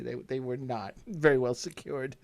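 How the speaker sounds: ordinary delivery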